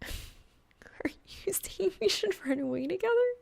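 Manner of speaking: a coy whispered tease; teasing suggestion. Acted. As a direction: tease him with a breathy, flirty whisper.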